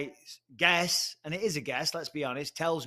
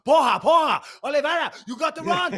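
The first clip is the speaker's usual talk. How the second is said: much higher, loud hollering at a fighter; acted shouting cornermen; 11 semitones higher, 11 dB louder than usual. Team in the sauna urging Oliveira to cut weight, high frantic shouting. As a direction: yell him on in a high, urgent voice. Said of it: "they're all in the sauna right now going".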